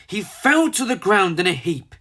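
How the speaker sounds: plain reading voice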